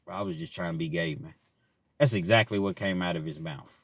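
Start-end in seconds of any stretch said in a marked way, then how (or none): 0.0-1.4 lower, much softer admission; sheepish breakdown. Retold or imitated. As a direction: murmur it, embarrassed and deflated. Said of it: "break down in front of the whole room".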